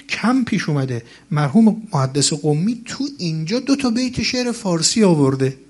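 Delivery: ordinary delivery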